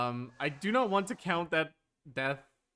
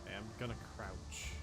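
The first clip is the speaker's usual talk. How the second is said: hushed; 4 semitones lower, 11 dB quieter, faster than usual. Real, not acted. Player sneaking past enemy.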